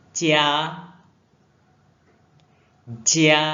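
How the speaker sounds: each word said loud and clear with long pauses; drill for repetition. Shown as a demonstration. Teacher reads words aloud for pupils to repeat. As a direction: model each syllable loudly for pupils to copy. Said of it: "Ikut Lao She".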